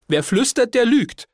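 aunt's high-pitched heckle; shrieky interjection from the aunt; calling out. A narrator's reading. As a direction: butt in with a high call. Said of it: "rief meine Tante dazwischen".

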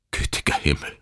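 whispered, horrified; a father whispering in horror; murmured. A narrator's reading. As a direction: breathe it in dismay. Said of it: "murmelte er".

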